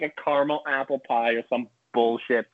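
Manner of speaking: usual speaking voice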